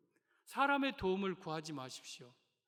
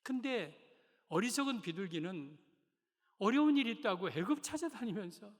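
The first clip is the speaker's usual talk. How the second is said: normal talking voice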